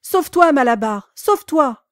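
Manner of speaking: loud high warning shout; chorus of warning cries. A narrator's reading. Animals shouting to Malabar, loud and high. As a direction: yell at him to flee. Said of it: "reprirent son cri".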